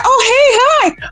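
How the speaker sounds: high nervous greeting; flustered client. Retold or imitated. Client at the door greeting her high and flustered. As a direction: squeak hello nervously. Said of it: "he's like".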